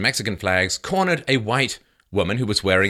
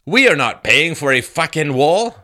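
mock protester shout; 5 semitones higher, 5 dB louder than usual. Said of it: "Another sign said".

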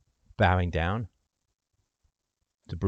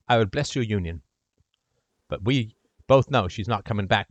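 ordinary talk

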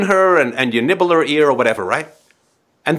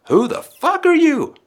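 high, indignant who-are-you; much higher mock baby outrage; outraged baby; 12 semitones higher than usual. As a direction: squeal it as the affronted baby. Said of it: "And then it's like".

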